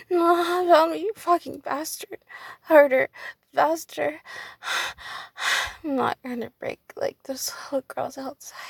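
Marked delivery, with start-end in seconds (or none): 0.1-4.2 much louder, insistent commands; loud demands. Real, not acted. Woman loudly demanding more from her partner. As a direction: bark out each demand.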